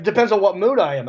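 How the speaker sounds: ordinary talk, nothing marked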